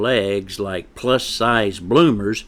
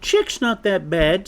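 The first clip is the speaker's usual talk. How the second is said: higher-pitched, mild pleading chick; protesting young chick; 11 semitones higher than usual. Acted. Chick defends friend to mother, protesting. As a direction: stick up for a friend, voice raised in pitch.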